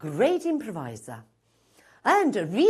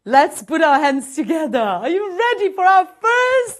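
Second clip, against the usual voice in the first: loud, high MC shout with stretched words; hyped stage introduction; 10 semitones higher, 12 dB louder, slower than usual. Real, not acted. Host bringing on the first act, hyped shout. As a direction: whoop the crowd up for the act.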